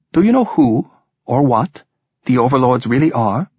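probing lilting Welsh question; sing-song Welsh voice. A narrator's reading. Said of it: "the sing-song voice replied".

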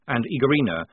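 ordinary delivery, nothing marked

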